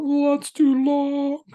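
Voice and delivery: high, loud, slow whine; whiny complainer. Retold or imitated. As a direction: drag it out like a moaning complainer. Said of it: "And some people are still".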